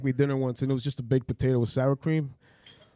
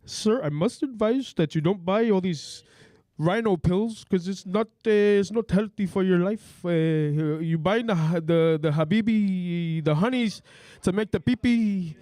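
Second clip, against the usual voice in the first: put-on comic Indian accent, slower and halting; hammed-up Indian accent with pauses. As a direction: put on a joking Indian accent, searching for words. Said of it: "your best Indian accent".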